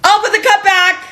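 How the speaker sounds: high, exasperated yell; yelling nag. Retold or imitated. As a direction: re-enact yelling at a husband like a horrible human. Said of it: "and I would yell l-, like a horrible, horrible human".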